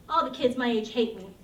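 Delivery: normal talking voice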